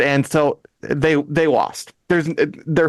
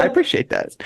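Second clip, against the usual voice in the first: laughing; faster than usual. Real, not acted. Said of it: "You should rethink that".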